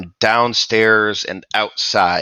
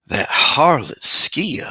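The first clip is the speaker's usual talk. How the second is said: whispered, slow and higher, full of contempt; sneering whisper; 8 semitones higher, 4 dB louder, slower than usual. Acted. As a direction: spit the name out in a hushed sneer. Lich sneers at rival.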